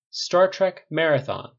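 slow, loud showman's reveal; grand announcement. A narrator's reading. Tony unveiling his movie pick, slow and grand.

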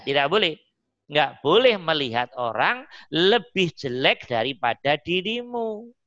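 ordinary talk

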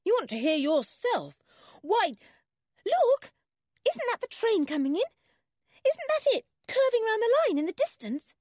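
put-on very high, loud girl's excitement; shrilling up with sudden excitement; excited spotting. A narrator's reading. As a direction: break off and cry out at the sight. Anne suddenly spots the train.

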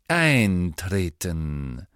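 very slow, loud, falling command; stern schoolmaster. Acted. Snape orders waiting pupils into class, stern. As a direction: draw the order out with cold authority.

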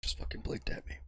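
softly, for the punchline; hushed punchline. Real, not acted. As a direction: drop to an awed whisper. Deadpan punchline after long pause.

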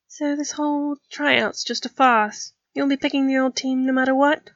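lightly voiced puzzled girl; questioning Rigel. A narrator's reading. Rigel questions Flint after a realisation.

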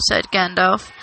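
usual speaking voice

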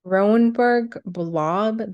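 ordinary talk

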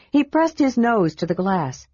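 plain reading voice

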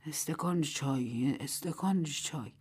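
low, soft and slow under the breath; muttering. A narrator's reading. Old Mrs Wang in bed asking for tea, soft slow mutter. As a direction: mumble it half asleep, slowly. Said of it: "زیر لب گفت".

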